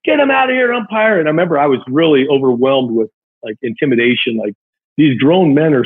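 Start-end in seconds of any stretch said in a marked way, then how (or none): 0.0-1.2 high-pitched mock yell of angry parents; retold angry yelling. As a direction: shout it up high like fathers at the fence. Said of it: "these guys were screaming on the chain link fence".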